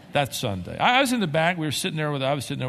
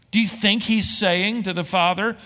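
normal talking voice

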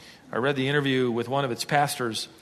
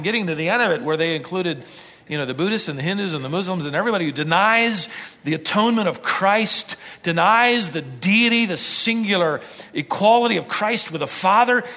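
ordinary delivery

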